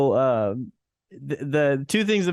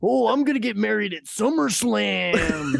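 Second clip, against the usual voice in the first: Macho Man voice; 7 semitones higher than usual. Retold or imitated. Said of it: "He's like".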